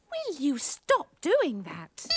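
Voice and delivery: ordinary talk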